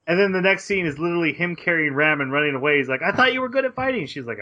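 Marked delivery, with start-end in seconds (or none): 3.0-4.1 high, fast incredulous protest; retold indignant complaint, pitched way up; mock complaint. Hero complaining to the girl he carries while running away, mock indignant.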